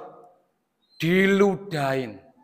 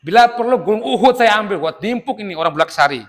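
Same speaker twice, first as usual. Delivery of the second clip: high, rapid mock indignation; exasperated; 6 semitones higher, much faster than usual. Jibril threatening the people of Bulak Sari, comic exasperation. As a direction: rattle off the threat, playfully fed up. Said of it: "Jibril pun nyampe jengkel".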